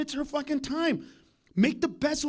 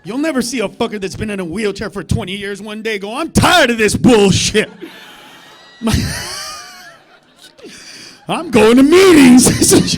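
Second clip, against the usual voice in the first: mock shout; 15 dB louder than usual. Comic playing a fed-up wheelchair man, laughing shout. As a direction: bellow it while cracking up.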